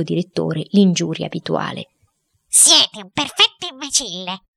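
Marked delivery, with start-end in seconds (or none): 2.5-4.4 high-pitched, venomous wife; furious insult. Acted. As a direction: hurl the insult in a raised, indignant woman's voice. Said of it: "si rivoltò tutta inviperita". (moglie inviperita, voce acuta)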